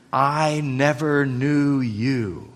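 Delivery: "I never knew you" slowly spaced out; emphatic stress. Shown as a demonstration. Preacher quoting Jesus's verdict, slow emphasis on "never knew you". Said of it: "That's the emphasis".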